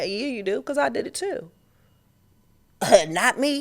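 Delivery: ordinary delivery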